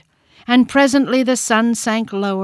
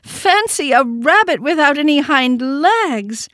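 higher, louder and slow taunting call; 7 semitones higher, 4 dB louder than usual. A narrator's reading. Wild rabbit calling out to mock the toy rabbit, loud and slow. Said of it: "he called out".

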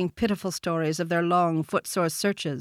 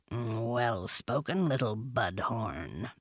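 low, soft, slow purr; very low, quiet feline drawl; purring panther; 6 semitones lower, 8 dB quieter, much slower than usual. A narrator's reading. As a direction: purr it slowly, like a big cat. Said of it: "Bagheera purred".

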